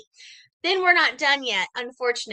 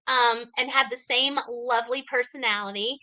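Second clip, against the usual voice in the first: ordinary talk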